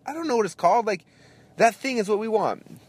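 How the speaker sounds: very high, insistent mock frustration; retold exasperation. Customer repeats his order to a confused server, high and exasperated. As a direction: re-enact ordering at the end of your patience.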